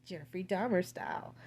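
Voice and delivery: usual speaking voice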